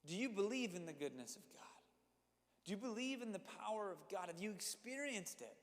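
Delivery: normal talking voice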